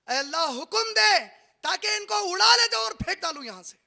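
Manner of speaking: high, loud forceful plea; wind pleading loudly. Acted. The wind begs God to blow sinners away, loud pleading. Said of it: "हवा कहती है रोजाना".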